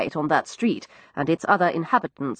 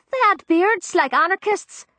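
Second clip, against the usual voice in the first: put-on higher, young cockney maid; frightened servant girl, higher cockney; Florrie's voice; 12 semitones higher than usual. Narrator voices East End maid.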